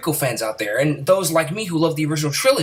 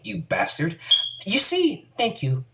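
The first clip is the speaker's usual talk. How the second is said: ordinary talk, nothing marked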